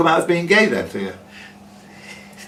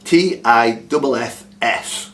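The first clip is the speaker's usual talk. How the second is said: slow, deliberate spelling of "W E E T I F F S", each letter separate; spelling "W E E T I F F S" slowly for the players; spelled out letter by letter; slower than usual. Shown as a demonstration. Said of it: "Wee Tiffs".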